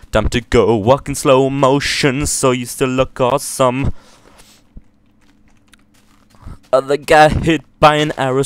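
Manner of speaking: loud, high dramatic narration; epic movie trailer. Pretend. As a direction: hype it like an action scene. Dramatic slow-motion narration.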